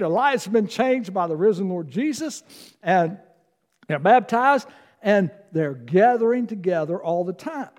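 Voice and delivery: ordinary talk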